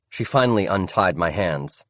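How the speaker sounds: ordinary delivery, nothing marked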